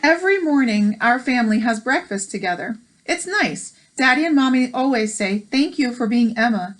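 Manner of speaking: bright storybook read-aloud; gentle picture-book narration; reading aloud to children. Acted. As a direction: read it like story time for kids.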